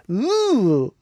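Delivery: a high drawn-out "Ooh"; an impressed rising "Ooh". Retold or imitated. Host imitating Barry's face, a high impressed ooh. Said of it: "best characterized by the sound".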